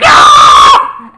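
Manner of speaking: deep loud war cry; low battle yell. Retold or imitated. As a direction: roar as you strike. Sword strike.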